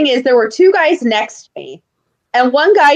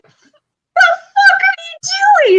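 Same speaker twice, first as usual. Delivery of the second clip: shrieking yell. Retold or imitated. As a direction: screech in mock outrage. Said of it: "I'm like".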